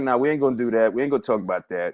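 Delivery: low, soft, quick self-warning; inner voice. Retold or imitated. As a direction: mutter it low to yourself, hurrying past. Telling himself to drop topic.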